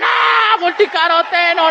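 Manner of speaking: high, drawn-out mock adoration; hammed-up gasp of product worship; awestruck crowd. Audience adoring a supplement being carried in, mock awe.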